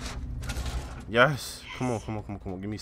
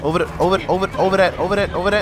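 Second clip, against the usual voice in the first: urgent, raised, high-pitched directions; hurried panicked calls to a teammate; being chased; 7 semitones higher, 4 dB louder than usual. Real, not acted. Fleeing the monster with teammate.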